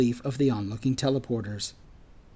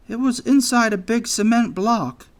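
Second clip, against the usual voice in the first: high, loud character voice; anxious anteater; 9 semitones higher, 5 dB louder than usual. A narrator's reading. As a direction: explain nervously, pitched well up.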